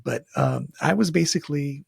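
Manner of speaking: plain reading voice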